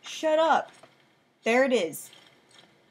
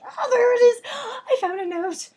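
high laughing cry of triumph; excited shout; 13 semitones higher, 9 dB louder than usual. Real, not acted. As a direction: shout the discovery, thrilled. Finding note, laughing scream.

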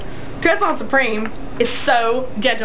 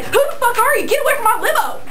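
mock snapping diva; 9 semitones higher, faster than usual. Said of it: "and I say".

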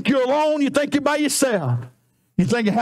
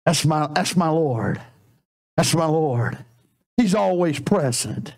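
lower, hushed devotion; tender, sincere; 9 semitones lower than usual. Real, not acted. Preacher speaking tenderly of his Lord to the church. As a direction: let it drop low and sincere.

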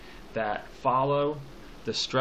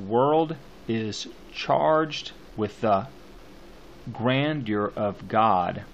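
slow beats on "World", "charged", "grandeur" and "God"; scanning the stresses; 3 semitones lower, much slower than usual. Shown as a demonstration. Teacher marking the poem's beats for students, slow stressed reading of "World is charged". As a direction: pull the line apart, leaning on "World", "charged", "grandeur", "God". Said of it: "if we're marking it, then we're gonna do this".

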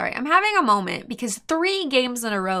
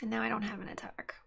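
softly whispered, deadpan sarcasm; whispered sarcastic aside to the viewers; 12 dB quieter than usual. Real, not acted. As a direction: mutter it under your breath, defeated. Dejected sarcasm, no attack.

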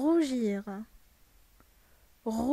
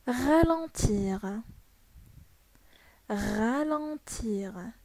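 slow model word; much slower than usual. Shown as a demonstration. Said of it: "يبطئ".

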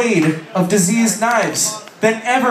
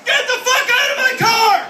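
much higher, laughing shout; mock furious yell. Passenger snooping the CD book and kicking the driver out, laughing mock yell. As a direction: throw them out of the car in mock outrage. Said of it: "And you're like".

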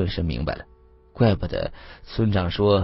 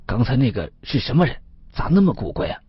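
higher-pitched, baffled questioning; incredulous; 4 semitones higher than usual. Acted. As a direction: ask in disbelief, pitch climbing. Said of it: "不可思议的看着我说". (不可思议地发问，语调升高)

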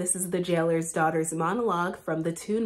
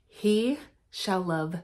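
slow resolve; 4 semitones lower, 4 dB quieter, slower than usual. Acted.

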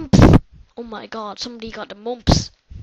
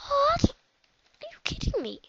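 disbelief; slower than usual. Real, not acted. Disbelief at game.

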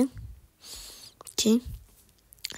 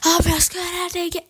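high-pitched boast; 9 semitones higher, 6 dB louder than usual. Real, not acted. Player crows about own skill.

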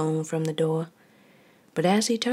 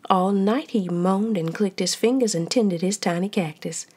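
ordinary delivery

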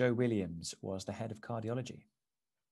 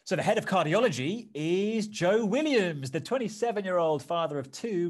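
bright, louder game-show reveal; upbeat reveal; 5 semitones higher, 7 dB louder than usual. Acted. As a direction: announce it like a quiz host. Presenter reveals the answer.

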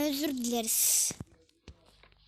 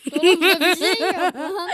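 laughing shout; 3 semitones higher, 13 dB louder than usual. Real, not acted. Shocked at empty fridge.

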